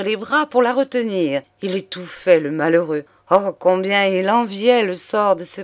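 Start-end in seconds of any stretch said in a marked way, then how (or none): none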